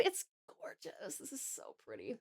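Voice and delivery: breathy whisper of awe over the art; hushed whisper. Real, not acted. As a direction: whisper it like you're afraid to break the spell.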